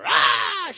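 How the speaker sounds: loud mock scream; sudden loud imitated yell. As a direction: cry out like a stung wife. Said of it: "She comes screaming out of the water".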